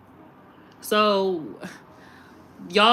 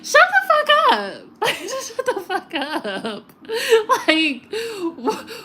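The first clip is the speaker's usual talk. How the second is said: exasperated laughter; 9 semitones higher, slower than usual. Real, not acted. Scoffing at tree-whisperer video, cracking up in exasperation. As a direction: squeal it, then crack up helplessly.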